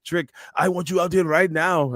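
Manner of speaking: high-pitched wrestler call-out in faked Scottish; bad Scottish accent. Podcaster imitating Joe Hendry calling out Trick, bad Scottish accent. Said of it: "Scottish voice, but he's like".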